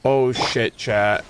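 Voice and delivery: usual speaking voice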